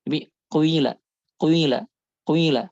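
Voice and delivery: kuila with a brief u before i; ishmam demo. Shown as a demonstration. Teacher showing ishmam on qila. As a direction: start kuila with a short dhammah gliding to kasrah. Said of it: "harokat dhammahnya lebih sedikit".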